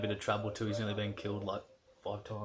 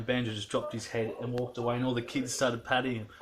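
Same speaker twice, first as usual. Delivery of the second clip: ordinary talk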